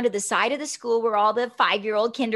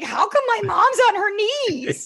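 much higher, giggling through it; laughing child's question; 8 semitones higher, 3 dB louder than usual. Retold or imitated. Little Tracy wonders why mom kneels, laughing. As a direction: laugh while asking like a puzzled kid.

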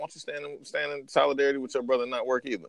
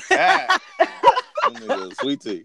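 high squealing laugh through the words; 5 dB louder, much slower than usual. Real, not acted. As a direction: cackle it out, slow and high. Teasing friend, laughing high.

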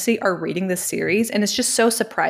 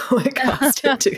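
talking through a laugh; amused, higher, laughing delivery; 6 semitones higher than usual. Real, not acted. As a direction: let a laugh break into the words. Laughing at her own joke.

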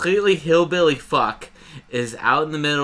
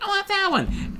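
put-on much higher, whiny kid repeating himself; little kid. Kid insisting to dad on the ugly pumpkin, squeaky high whine. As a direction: nag like a small child fixated on one pumpkin. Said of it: "And he's like".

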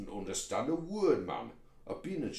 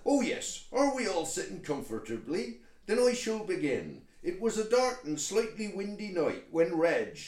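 raised, loud West Country storytelling; scene-setting storyteller; 5 semitones higher, 5 dB louder than usual. Acted. Toad begins reading the letter to the meeting, grand storyteller. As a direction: open a tale with theatrical relish. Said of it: "I was just setting the scene".

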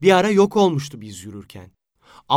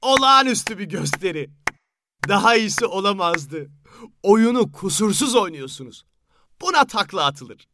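mocking applause; 6 semitones higher than usual. Can laughing and clapping mockingly.

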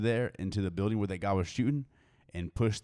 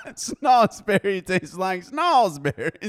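high, slow, laughing quote; 10 semitones higher, 8 dB louder, much slower than usual. Retold or imitated. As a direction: laugh through the line.

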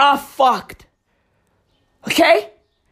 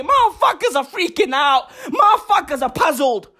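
ordinary talk

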